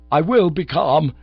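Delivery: loud, slow insistence through clenched frustration; upset Bingo insists he is calm, loudly; agitated. A narrator's reading. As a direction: force out self-control while losing it. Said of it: "knocking over an occasional table".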